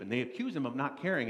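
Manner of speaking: ordinary delivery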